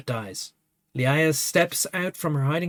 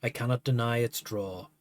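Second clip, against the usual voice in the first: poem reading; 4 semitones lower, 5 dB quieter than usual. Acted. Reads a poem aloud.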